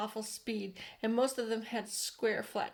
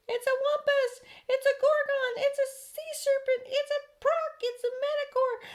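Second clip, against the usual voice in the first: panicked shout; 8 dB louder than usual. A narrator's reading. Firemen shouting in fright at the centipede. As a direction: shriek the warning in panic. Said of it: "all started shouting".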